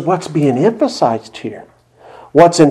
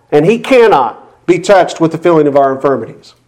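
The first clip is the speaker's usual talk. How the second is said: ordinary talk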